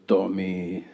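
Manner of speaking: put-on low-pitched Peruvian-accented mom; mother's Peruvian accent. Mom phoning her son, in a Peruvian accent. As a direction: imitate a Peruvian mother's English. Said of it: "she's from South America".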